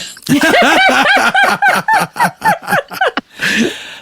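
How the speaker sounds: bright burst of laughter; high laugh. Real, not acted. Outing self on LinkedIn.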